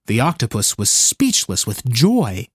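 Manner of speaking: usual speaking voice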